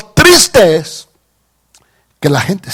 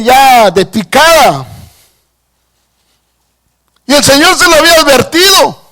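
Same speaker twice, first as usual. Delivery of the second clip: raised, intense preaching; fervent preaching; 7 semitones higher, 4 dB louder than usual. Acted. Pastor preaching to the church, fervent. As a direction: preach it to the congregation.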